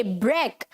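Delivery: ब्रेक as short low "brek"; correct pronunciation. Shown as a demonstration. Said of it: "तो कैसे बोलेंगे इसे?".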